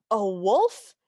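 mock-thrilled granny, rising on the question; imitated lusty excitement. Fairy godmother hearing of a wolf, playfully lusty excitement. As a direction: play up a granny's eager interest. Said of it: "in a very Yahoo, in a Granny's gonna get laid kind of way".

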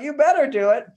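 high-pitched imitation of entitlement; mock demanding wife. Mimics wife's demanding attitude to husband.

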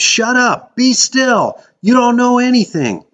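high, loud furious screaming; Aaron screaming his friends into silence; screamed. A narrator's reading. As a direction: scream them down. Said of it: "Aaron screamed".

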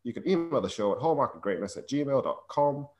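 announcer read. Acted. Reads the show's plug.

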